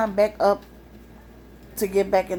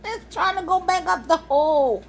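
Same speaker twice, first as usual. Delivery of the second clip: high, shocked discovery; imitating a spooked kid; startled gasp; 8 semitones higher than usual. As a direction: retell her alarm, pitched well up. Daughter sees raccoon through door.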